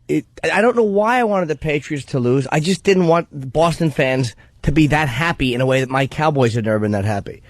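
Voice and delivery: ordinary talk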